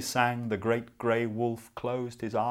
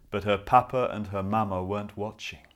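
plain reading voice

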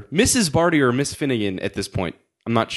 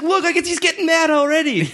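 high-pitched mimicked teasing; gloating teacher; 12 semitones higher than usual. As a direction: imitate a teacher delighting in someone getting mad. Teacher gleefully mocks the boy.

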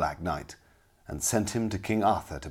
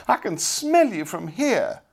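high, loud, drawn-out disgust; angry cry; 12 semitones higher, 6 dB louder than usual. A narrator's reading. Lady insults the kitchen youth, crying angrily. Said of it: "cried the lady angrily".

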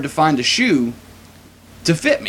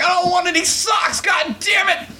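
much higher, yelled in laughing mock rage; furious shout; 14 semitones higher than usual. Retelling yelling at store workers pulling him off, hammed-up shout. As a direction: scream it while thrashing free. Shouting while fighting clerks.

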